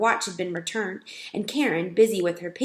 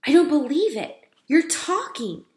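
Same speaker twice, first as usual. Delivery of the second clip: amazed; 6 semitones higher, 4 dB louder than usual. Acted. Boy amazed mouse speaks.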